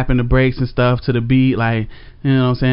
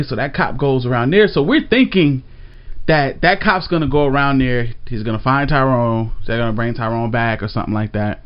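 usual speaking voice

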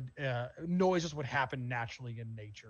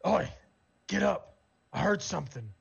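loud, acted Orcish wake-up call; feminine yell; 5 semitones higher, 5 dB louder than usual. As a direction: call it out roughly to rouse a sleeping companion. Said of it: "a feminine voice kinda yell this out loud".

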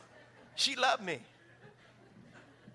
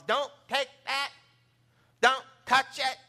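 mom scolding; 8 semitones higher, 7 dB louder, slower than usual. Retold or imitated. Mom scolding child in story.

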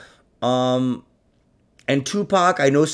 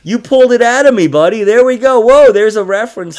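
loud, fast, high excited yell; 8 semitones higher, 9 dB louder than usual. Real, not acted. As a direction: burst out with glee. Excited banter.

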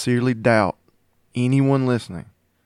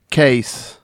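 ordinary delivery

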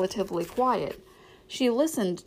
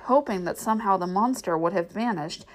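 plain reading voice

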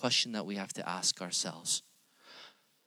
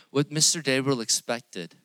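normal talking voice

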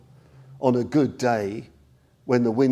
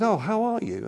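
much higher, bright greeting; the teacher Sangharakshita greeting a student on the phone, pitched high; 11 semitones higher than usual. Retold or imitated. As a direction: lift the voice high for a cheery hello. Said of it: "he said".

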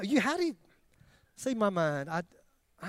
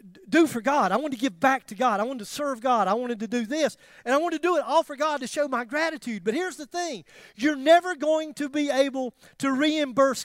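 rising, louder preacher's fervour; preaching; 7 semitones higher, 5 dB louder than usual. Acted. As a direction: build it like a pulpit appeal.

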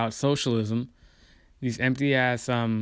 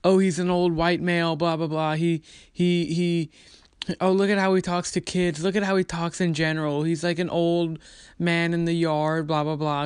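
higher-pitched dismissive parody; mock complaining pundit voice; mocking critics; 6 semitones higher than usual. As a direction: imitate petty critics, pitch raised, trailing into blahs. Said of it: "they just go after him".

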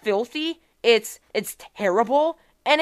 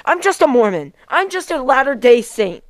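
ordinary talk, nothing marked

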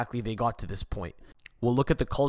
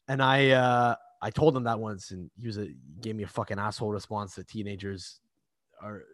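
normal talking voice

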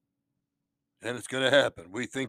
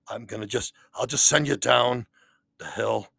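usual speaking voice

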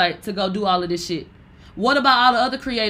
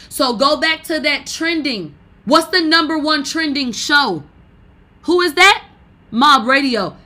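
rising higher and louder; heated rant; 5 semitones higher, 7 dB louder than usual. Real, not acted. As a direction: build to a shouting challenge. Escalating rant, rising pitch.

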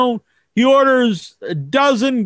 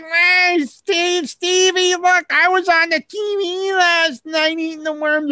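put-on higher, excited little kid showing off; bragging kid voice; 7 semitones higher than usual. Kid boasting to his friend Stevie in a high kid voice. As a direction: brag like a kid who was on TV.

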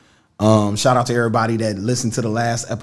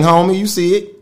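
normal talking voice

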